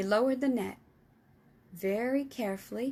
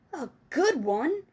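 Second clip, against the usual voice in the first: slow, loud, high disbelief; incredulous cry; 5 semitones higher, 6 dB louder than usual. Acted. Giant amazed that Sophie asks if it's good. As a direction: scoff at the question. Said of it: "he cried".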